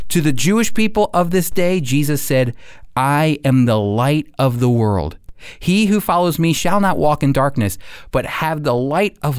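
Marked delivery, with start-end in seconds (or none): none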